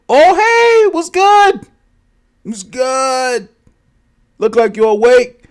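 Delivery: very high, loud cartoonish greeting; put-on high-pitched cheery character; chirpy stranger. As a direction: greet the captive in a silly high voice. Stranger greets the waking captive.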